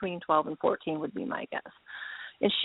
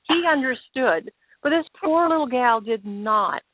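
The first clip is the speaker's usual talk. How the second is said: ordinary talk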